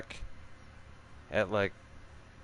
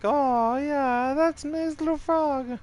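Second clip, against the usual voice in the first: squeaky falsetto, pitched way up; mock high squeal; 4 dB louder than usual. Spotting a little frog by the lake, squeaky high voice. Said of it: "and you're like".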